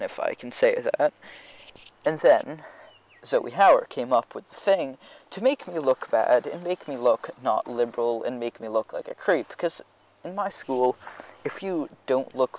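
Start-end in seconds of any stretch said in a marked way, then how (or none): none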